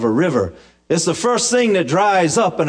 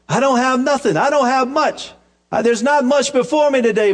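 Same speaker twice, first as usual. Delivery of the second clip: higher, pleading protest; widow's protest; 6 semitones higher than usual. Retold or imitated. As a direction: protest that there's nothing left.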